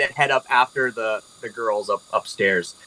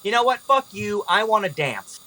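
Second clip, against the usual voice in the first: defiant outburst; 8 semitones higher than usual. Acted. Olivia storms off mid-quarrel.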